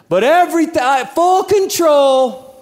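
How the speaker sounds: slow and stretched, a sanctimonious wail; mock pious cry. Preacher mocking believers who pray for full control, drawn-out and loud. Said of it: "mocking pious prayer".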